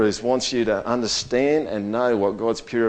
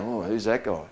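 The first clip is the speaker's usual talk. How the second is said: admiring murmur; 4 semitones lower, 10 dB quieter than usual. Retold or imitated. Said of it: "People going".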